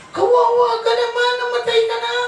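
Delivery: very high put-on squeal of pity; exaggerated high-pitched "poor thing"; mock pitying voice. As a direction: pity them in a tiny, very high voice. Mocking onlookers' pity for the dead.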